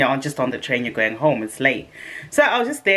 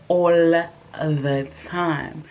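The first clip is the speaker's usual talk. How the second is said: very slow drawl; much slower than usual. Real, not acted. Drawn out.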